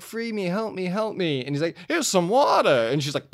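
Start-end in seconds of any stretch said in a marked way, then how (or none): 0.0-1.4 high-pitched mock damsel begging; mock pleading. Acted plea of trapped woman. 1.9-2.9 high, loud hammed-up offer; mock helpful offer. Acted man offering water to her.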